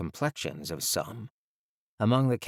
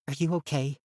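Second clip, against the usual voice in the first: put-on higher, gentle girl voice; girl's voice; 6 semitones higher than usual. A narrator's reading. Girl asking frightened boy.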